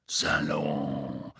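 low growl of one word; growled warning. Acted. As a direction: growl it like a gruff auror. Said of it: "knurrte er".

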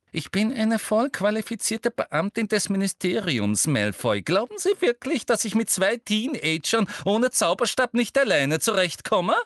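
high, biting, indignant retort; snappish. A narrator's reading. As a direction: bite back, offended at the doubt. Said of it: "fragte Umbridge bissig".

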